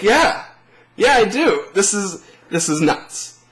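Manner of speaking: thrilled yes. Retold or imitated. Invited to red carpet.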